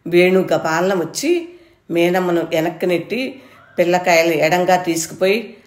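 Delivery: ordinary delivery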